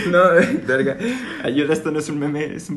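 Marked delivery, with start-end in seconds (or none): 0.0-1.0 loud laugh spilling over the words. Real, not acted. Host bursts out laughing at his own dark joke.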